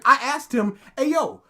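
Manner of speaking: ordinary talk, nothing marked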